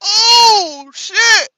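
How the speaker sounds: high, loud whoop breaking into laughter; gleeful shock. Real, not acted. Reacting to the clip's answer.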